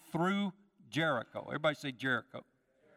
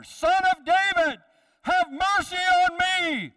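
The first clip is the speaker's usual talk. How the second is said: very high, loud, slow pleading cry; louder shout; 11 dB louder, slower than usual. Acted. Blind man cries louder over the hushing crowd, pleading. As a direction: cry out with everything, stretching each word. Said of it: "He shouted louder".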